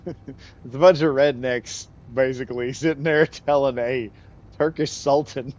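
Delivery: loud, laughter-filled telling; laughing. Real, not acted. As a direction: barely hold back the laugh while talking. Laughing while describing the letter.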